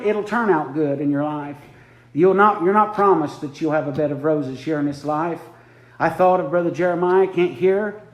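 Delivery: ordinary delivery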